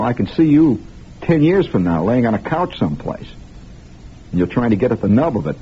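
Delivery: ordinary talk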